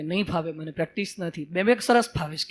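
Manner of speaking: small creature insisting shyly; meek lion cub. Acted. Cub shows the lion its ID card, meek and soft.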